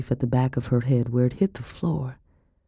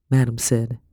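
usual speaking voice

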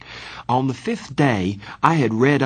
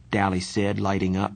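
ordinary delivery, nothing marked

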